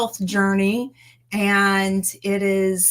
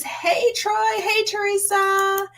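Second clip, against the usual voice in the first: high-pitched greeting; 12 semitones higher, slower than usual. Retold or imitated. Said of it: "Xander says".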